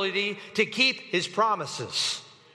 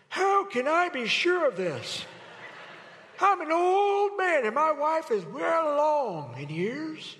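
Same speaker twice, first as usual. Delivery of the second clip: put-on higher, slow, quavering old man; 6 semitones higher than usual. Shown as a demonstration. Preacher redoing the line as a frail old man. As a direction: play Zechariah as a doubting old man. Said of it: "Let me do it again".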